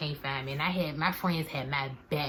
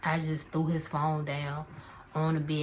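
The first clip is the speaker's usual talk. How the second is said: ordinary talk, nothing marked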